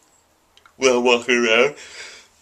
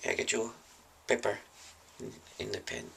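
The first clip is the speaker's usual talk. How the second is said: much softer, fading away; timid mumble; 16 dB quieter than usual. Acted. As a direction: mumble it shyly, barely there. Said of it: "I'm like".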